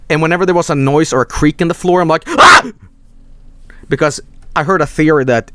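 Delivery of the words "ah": high, loud startled shriek; scared yelp. Retold or imitated. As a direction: yelp at a creak in the dark. Scared kid hearing floor creak.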